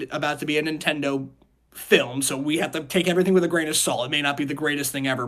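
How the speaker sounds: ordinary talk, nothing marked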